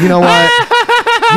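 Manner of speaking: high, slow, laughing squeak; mock squeaky voice. Mimicking video, laughing.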